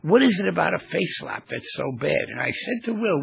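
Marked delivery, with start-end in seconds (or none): none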